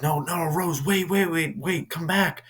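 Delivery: high, loud desperate calling after her; a worried coworker shouting after Rose as she drives away. Retold or imitated. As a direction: plead for her to come back. Coworker calls after her leaving car.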